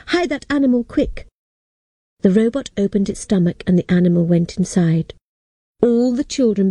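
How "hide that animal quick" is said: higher, urgent call to hide it; a touch of alarm, calling out; urgent shout. Acted. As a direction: hurry the robot, a mild shout. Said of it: "I shouted to the robot".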